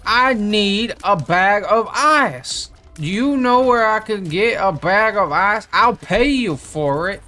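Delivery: high, loud, slow pleading stranger; imitating the stranger. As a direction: beg loudly and slowly for a bag of ice. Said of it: "the guy was like".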